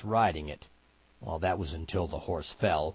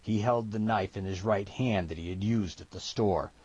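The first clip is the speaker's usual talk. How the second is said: ordinary delivery, nothing marked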